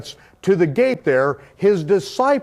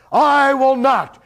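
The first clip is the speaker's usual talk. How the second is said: high, louder, slow refusal; emphatic refusal; 6 semitones higher, 3 dB louder, slower than usual. Retold or imitated. Yahshua refusing the priests again, a high emphatic refusal. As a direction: repeat it with full force. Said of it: "And he said".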